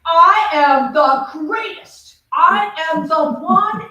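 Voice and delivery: big slow declaration, booming; slow, loud proclamation of greatness; proclaiming loudly. Retold or imitated. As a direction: announce it like a prophet to the world. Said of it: "and he said".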